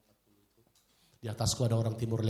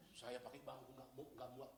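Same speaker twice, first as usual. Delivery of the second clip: ordinary delivery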